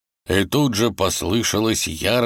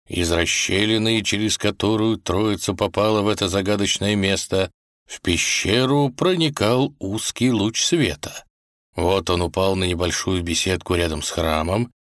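ordinary delivery, nothing marked

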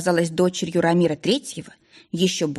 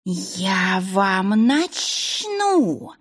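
very slow, strange indignant creature voice; outraged little snake familiar; much slower than usual. A narrator's reading. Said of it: "Неожиданно возмутилась Буся". (возмущённая змейка)

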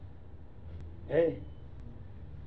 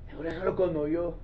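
usual speaking voice

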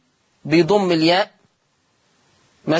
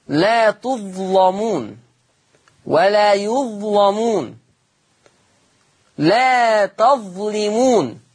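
Quran recitation; 2 semitones higher, much slower than usual. Acted. Slow Quran recitation in lesson.

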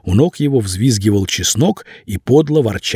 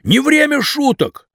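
put-on indignant young man. A narrator's reading. Vasya protests being dragged.

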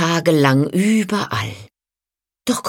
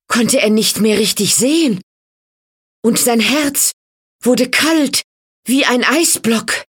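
higher, intense storyteller's build; dramatic narration; 9 semitones higher, 3 dB louder than usual. A narrator's reading. Narrator tells of the boy's heart turning to ice, dramatic. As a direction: tell it with mounting drama, phrase by phrase.

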